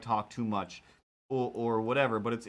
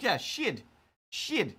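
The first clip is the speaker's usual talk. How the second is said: New Zealand "shed" said like "shid", higher; imitating a Kiwi saying "shed" as "shid"; New Zealand accent; 8 semitones higher, slower than usual. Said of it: "accent-wise".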